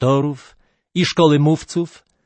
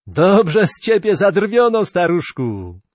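mocking laughter; 5 semitones higher than usual. A narrator's reading. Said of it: "Mikron począł się śmiać serdecznie".

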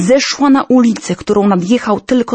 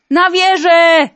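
high panicked call, the second slower; 8 semitones higher, slower than usual. Acted. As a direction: cry out to the crowd to run to the tower. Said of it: "wołano".

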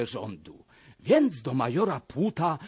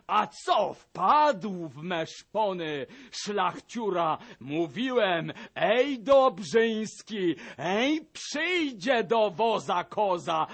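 higher, mocking and harsh throughout; gloating major; 8 semitones higher, 5 dB louder than usual. A narrator's reading. Major taunts the captured gentry, sneering and loud. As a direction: crow over the beaten gentry like a vindictive officer.

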